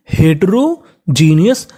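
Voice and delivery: Heterogeneous slowly broken in two; teacher slowly splitting up heterogeneous; slowly in parts. Shown as a demonstration. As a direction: sound out heterogeneous with a pause mid-word. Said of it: "जिसे हम लोग speed में बोलेंगे".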